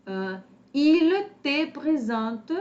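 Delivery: il te présente drawn out slowly, il lifted high and loud; slow stressed model. Shown as a demonstration. Teacher slowly models il te présente for her students. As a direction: spell out il te présente slowly for the class. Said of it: "teacher models sentence slowly".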